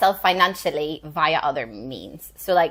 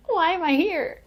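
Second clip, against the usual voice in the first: high-pitched mock puzzled question; retold bewilderment; 10 semitones higher than usual. Actor wondering why she was auditioned, high bewildered voice. Puzzled reaction to casting mismatch.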